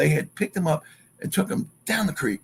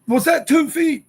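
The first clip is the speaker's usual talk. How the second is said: high, louder, stunned asking; alarmed question; 5 dB louder than usual. Retold or imitated. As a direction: disbelief pitched way up. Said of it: "And I'm like".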